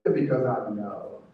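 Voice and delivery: deep, unhurried imitation of a mentor; knowing mentor. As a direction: say it like a wise old mentor who's seen it all. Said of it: "That's exactly what he said".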